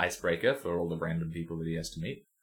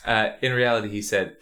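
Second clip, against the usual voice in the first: ordinary delivery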